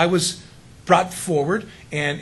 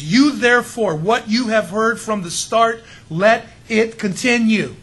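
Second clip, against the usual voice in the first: loud, raised, "You" struck first; higher, weighty reading led by a punched "You"; emphatic YOU; 4 semitones higher, 6 dB louder, slower than usual. Shown as a demonstration. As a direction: hit "You" hard, then slow into a forceful declaration. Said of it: "It's emphatic".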